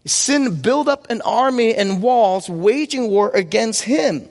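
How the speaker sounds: usual speaking voice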